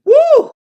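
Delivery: high, loud whooping cry; mock whoop. Showing how close he gets to snapping, whooping loud and high. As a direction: let out a wild whoop. About to lose it on the edge.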